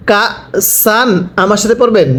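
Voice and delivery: ordinary delivery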